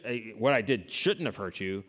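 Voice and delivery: usual speaking voice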